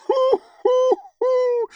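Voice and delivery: put-on hoo hoo hoo, shrill and high, old man laughing; high hooting laugh. A narrator's reading. Gradi, amused at his own joke, hooting laugh. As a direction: hoot with delight.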